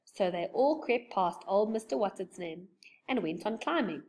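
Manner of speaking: ordinary delivery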